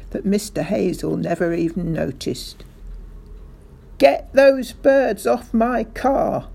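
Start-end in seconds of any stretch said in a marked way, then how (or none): 4.0-6.5 slow, loud, high furious bellow. A narrator's reading. Mister Hazel wants birds off his car, bellowing. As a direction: bellow each word at them. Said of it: "Mister Hazel bellowed".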